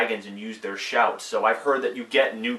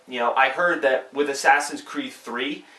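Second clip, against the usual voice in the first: ordinary delivery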